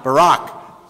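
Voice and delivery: Barak said clearly, rising at the end; teaching the Hebrew word Barak; saying barak aloud. Shown as a demonstration. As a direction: model the word Barak for the room to repeat. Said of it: "Say it".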